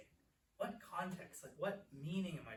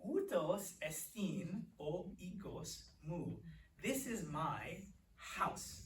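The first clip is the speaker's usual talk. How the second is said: slow, measured words for learners; slow modelling; much slower than usual. Shown as a demonstration. Teacher demonstrates a Greek sentence to class, slow modelling. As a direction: draw out each word, Greek then English.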